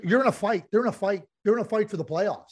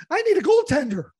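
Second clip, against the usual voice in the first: higher, urgent voice of a GM, fast at the end; imagined GM; 5 semitones higher than usual. Retold or imitated. Host voicing Ken Holland's urgent thinking, higher and hurried. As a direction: play the worried manager needing a goalie. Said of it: "I'm going, like".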